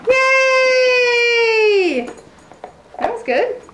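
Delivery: high, loud cheer. Real, not acted. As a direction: cheer her on. Cheering the baby's steps.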